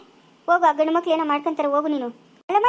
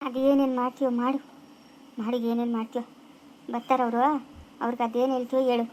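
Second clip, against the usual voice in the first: low and much softer, bitter; bitter resignation; 5 semitones lower, 6 dB quieter than usual. Acted. Wife to husband, bitterly resigned and quiet. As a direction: let it drop into a cold murmur.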